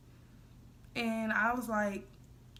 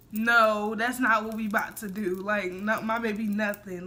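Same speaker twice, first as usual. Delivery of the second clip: firm loud no; 7 dB louder than usual. Retold or imitated. Shutting down friend's excitement.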